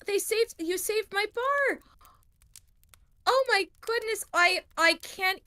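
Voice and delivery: overwhelmed thanks, lingering on the key words; grateful tavern keeper. Acted. Dora thanks the party.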